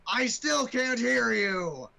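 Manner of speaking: slow, loud captain bellow; slow captain shout. Pretend. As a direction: bellow it out slowly. Captain demands louder.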